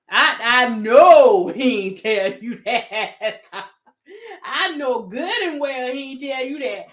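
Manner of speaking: helpless laughing disbelief. Real, not acted. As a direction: crack up while insisting. Cracking up at an unbelievable claim.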